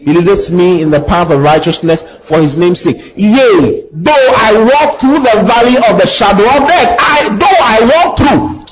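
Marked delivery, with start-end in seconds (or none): 3.2-8.7 higher, ringing recital of the psalm; fervent psalm recital. Acted. Preacher reciting the valley psalm to the church. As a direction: cry the verse out to heaven.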